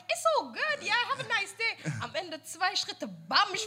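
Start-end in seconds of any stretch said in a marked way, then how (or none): 0.1-0.8 much higher-pitched, insistent brush-off; overdone reassurance. Retold or imitated. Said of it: "I'm like". 0.8-1.8 high-pitched, hurried-off farewell to a stranger; overdone reassurance. Retold or imitated. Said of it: "I'm like".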